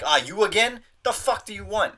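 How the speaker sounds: hammed-up annoyed shop worker; rude clerk. Imagining a hostile receptionist, mock annoyed. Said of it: "they were like".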